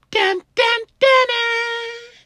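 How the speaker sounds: high, loud sung "dun dun"; dramatic sting. Retold or imitated. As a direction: hum a dramatic movie sting. Dramatic sound effect.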